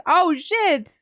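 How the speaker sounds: ordinary talk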